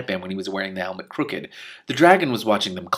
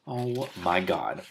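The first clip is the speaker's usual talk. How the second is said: disbelief; 4 dB quieter, much slower than usual. Real, not acted. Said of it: "reader reacts aside".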